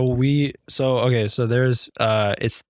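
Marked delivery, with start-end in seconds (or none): none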